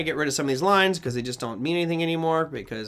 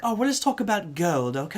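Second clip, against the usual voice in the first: put-on Australian accent; 3 semitones higher than usual. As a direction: put on a lilting Australian TV host. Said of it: "this lovely Australian accent".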